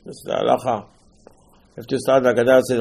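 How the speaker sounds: usual speaking voice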